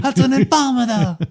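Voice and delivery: high, strained caricature of a Don; mock-outraged mob Don. Mock Don outraged at drug offer.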